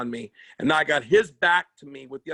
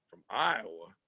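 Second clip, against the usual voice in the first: scoffing; 10 semitones lower, 9 dB quieter than usual. Retold or imitated. Skinhead scoffing at Iowa.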